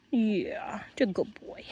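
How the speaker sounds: whispered soft praise. Real, not acted. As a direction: breathe the praise without voice. Soothing the dog.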